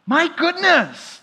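slow, high "my goodness" in wonder; amazed exclamation. Real, not acted. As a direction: awestruck cry. Preacher marvels at the verses.

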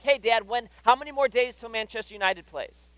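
lightly voiced impatient little boy; eager kid. Son pestering dad about the next game, eagerly.

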